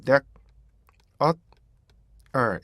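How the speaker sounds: "that, uh, er" as three separate beats. Shown as a demonstration. Teacher splitting the word into three beats. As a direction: split "there" into "that, uh, er". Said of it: "이렇게 읽어요".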